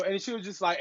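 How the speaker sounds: ordinary talk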